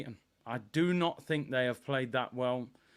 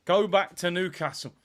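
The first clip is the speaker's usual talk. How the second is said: ordinary delivery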